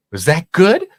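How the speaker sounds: put-on eager kid seeking approval, high-pitched; hopeful high question. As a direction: ask the god up high and hopeful. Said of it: "I'm like".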